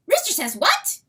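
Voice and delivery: very high, loud, wondering little weasel; excited weasel voice. Acted. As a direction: squeal it out in awe as the small weasel. Weasel asks.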